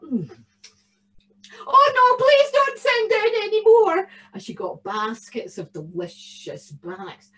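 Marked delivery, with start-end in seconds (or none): 1.7-4.1 put-on very high, loud wailing old woman; an old lady pretending to be horrified; mock-distressed old lady. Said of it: "the old lady said".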